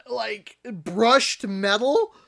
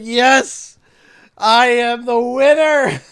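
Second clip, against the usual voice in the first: slow, louder mock victory cry; kid's proud cheer; 9 dB louder, much slower than usual. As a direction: crow about winning like a little champ. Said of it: "He's like".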